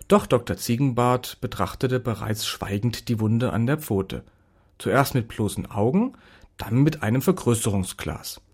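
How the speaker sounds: plain reading voice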